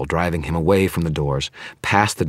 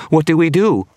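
panicked shriek, much higher and louder; shaking voice; 13 semitones higher, 8 dB louder than usual. Acted. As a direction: beg for an answer in terror. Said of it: "His voice was shaking".

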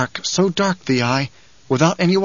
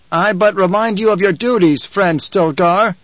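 higher, insistent tribesman; pointed reminder; 6 semitones higher, 3 dB louder than usual. Acted. Man above reminds Stilgar of duty, pointedly. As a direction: press your leader with formal insistence.

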